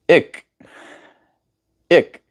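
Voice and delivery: high-pitched Cape accent "Ik". Shown as a demonstration. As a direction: pop out "Ik" with a tight K P accent vowel. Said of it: "in the K P accent".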